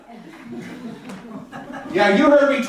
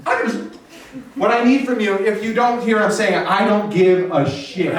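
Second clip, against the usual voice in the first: normal talking voice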